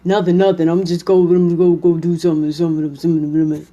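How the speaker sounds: low, grumbling brush-off, words trailing into mumbles; sulky mumbling husband. Acted. Husband brushing off his wife's question, low sulky mumble. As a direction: mutter it away like a sulking man who won't talk. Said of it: "mumble to yourself".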